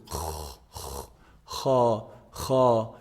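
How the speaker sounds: kha, kha, rasp it from the upper throat; Arabic kha, deep and rough; deep raspy kha. Shown as a demonstration. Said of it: "You can feel the vibration in this part".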